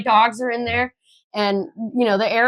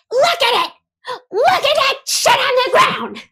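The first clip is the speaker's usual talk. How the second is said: very high, shrieked orders; hammed-up shrill tirade; imitated screaming; 4 dB louder than usual. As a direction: screech like a hysterical complainer. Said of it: "she starts screaming at me".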